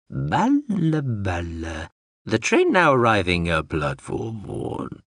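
slow, strange, singsong machine babble; a confused robot drone rambling; babbling drone voice. Acted.

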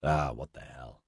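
ordinary delivery

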